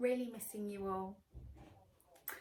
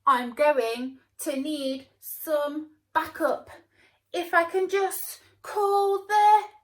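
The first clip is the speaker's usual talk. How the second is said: loud, slow heroic voice; rally yourself for backup; 7 semitones higher, 14 dB louder, slower than usual. Acted. Hero needs backup.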